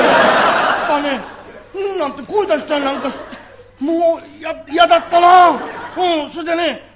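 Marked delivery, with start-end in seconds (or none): 0.0-5.6 put-on higher, louder child's yuck; squirming disgust. Child to father, squirming at what she saw. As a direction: cringe as you say it.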